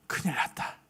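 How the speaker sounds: zacht, bijna stemloos fluisteren van paniek; angstige gedachte. Acted. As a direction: fluister het als iemand die zich in het nauw voelt. Woman's frightened inner thought.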